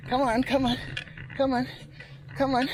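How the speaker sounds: urging through effort. Real, not acted. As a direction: urge yourself up a steep climb. Urging the bike up the hill.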